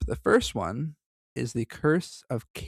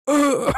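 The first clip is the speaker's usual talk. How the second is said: sharp high strangled groan; a dying gasp, hammed up; death rattle; 7 dB louder than usual. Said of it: "I make that sound. Like, go".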